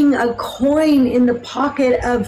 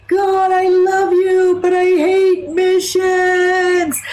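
drawn-out, raised-pitch heartfelt outcry to God; heartfelt cry; 6 semitones higher, slower than usual. Retold or imitated. Worn-out missionary crying out to God, heartfelt. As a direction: cry it out slowly, high and held, meaning every word. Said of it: "I just meant it with all my heart".

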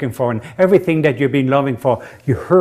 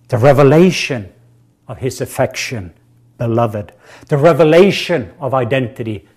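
louder, rising preacher's build on each revelation; fervent preaching; 4 dB louder than usual. Acted. Preacher building fervor before the congregation. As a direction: preach it with mounting fire.

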